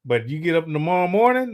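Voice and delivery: ordinary delivery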